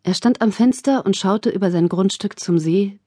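ordinary delivery, nothing marked

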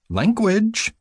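high, prim one-word reproof; admonishing. A narrator's reading. Nurse correcting patient's swearing, high admonishment. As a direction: pitch it high as a reprimand. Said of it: "she admonished".

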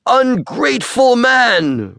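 high, slow furious roar; roaring beast. Acted. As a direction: roar it out in outrage. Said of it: "roared the beast".

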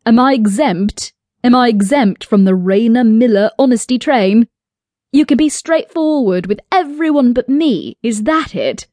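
exasperated and confrontational, voice pushed up; indignant challenge. A narrator's reading. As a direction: fire off accusing questions. Liam confronts Ray, demanding answers.

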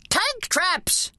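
put-on muffled high old soldier shout. A narrator's reading. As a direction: call out from inside a bin. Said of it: "came Gramps' muffled voice".

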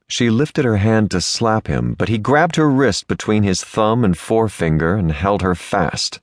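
ordinary delivery, nothing marked

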